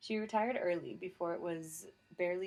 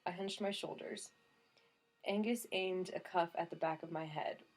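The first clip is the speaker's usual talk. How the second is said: ordinary delivery, nothing marked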